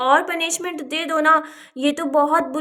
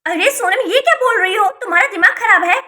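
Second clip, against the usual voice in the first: raised, indignant voice; shocked scolding; 3 semitones higher, 6 dB louder than usual. Acted. Aunt to niece who insulted her, shocked scolding. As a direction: scold loudly in disbelief.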